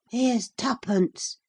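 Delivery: usual speaking voice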